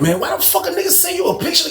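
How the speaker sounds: high, shocked question; asking his homeboy in shocked disbelief, voice way up; incredulous. Retold or imitated. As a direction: squeak it out in disbelief. Narrator incredulous at friend.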